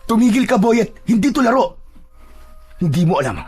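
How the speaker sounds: lightly voiced stern priest. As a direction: warn a child off sternly. Voices priest scolding the boy.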